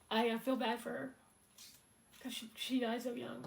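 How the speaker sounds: real sorrow breaking through a small laugh; emotional. Real, not acted. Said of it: "I'm a little bit emotional about it".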